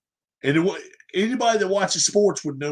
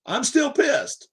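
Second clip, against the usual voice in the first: higher, slow mocking repeat of still pissed; mocking echo; 9 semitones higher, slower than usual. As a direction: echo his words back higher and slowly, scoffing. Mocking repeat of player's quote.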